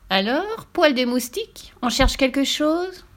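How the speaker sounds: high, slow taunting witch. Acted. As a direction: sneer at the boy from above. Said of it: "le regardait sévèrement".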